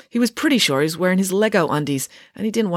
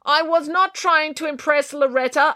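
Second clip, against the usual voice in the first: arguing; 11 semitones higher than usual. A narrator's reading. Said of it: "argued Finn".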